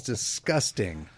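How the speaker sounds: ordinary talk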